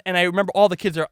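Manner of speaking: ordinary talk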